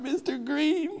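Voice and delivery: put-on a six-year-old sobbing over math; crying little boy. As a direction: stretch it out like a weepy kid. Six-year-old breaking into tears.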